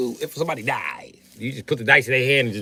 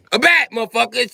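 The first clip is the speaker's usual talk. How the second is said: usual speaking voice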